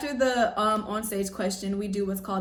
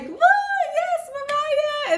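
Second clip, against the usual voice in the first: high, slow delighted shriek of the sister; excited scream; 7 dB louder, slower than usual. Retold or imitated. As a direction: squeal it way up high with joy. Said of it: "she started screaming".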